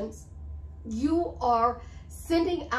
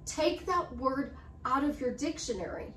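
usual speaking voice

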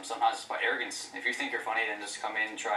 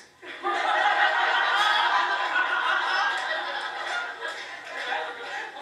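crowd laughter; 5 dB louder than usual. Real, not acted. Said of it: "audience laughter".

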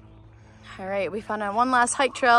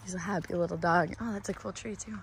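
ordinary delivery

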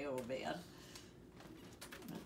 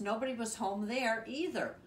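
ordinary delivery

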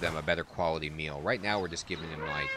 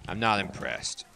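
louder, mock-haughty cat voice; unimpressed tiger; 4 dB louder than usual. Gamer voices tiger walking off, mock disdain. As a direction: sniff at the meal like a snooty cat. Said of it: "He's like".

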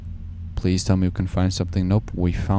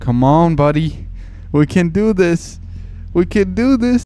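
excited; 10 semitones higher, 5 dB louder, slower than usual. Real, not acted. Hyping himself up.

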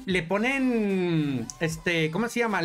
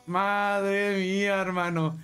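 awed; slower than usual. Real, not acted. Unboxing the YouTube plaque.

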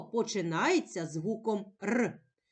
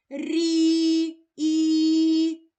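"Рі, і" held long, loud and level, almost sung; drawn-out vowel; 5 semitones higher, 8 dB louder than usual. Shown as a demonstration. Teacher picking out the vowel for a child, drawn out. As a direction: sustain a high, loud і in "Рі", then alone.

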